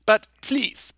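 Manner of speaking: normal talking voice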